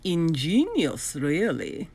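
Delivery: slow, lightly voiced uneasy villain. Doctor Brock eyes the reducer, lightly voiced nervous praise. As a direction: praise it slowly through unease.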